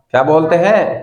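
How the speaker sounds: normal talking voice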